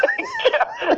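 very high burst of laughter; high shrieking laugh. Real, not acted. Listener shrieking with laughter at the fight story.

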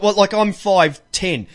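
ordinary talk, nothing marked

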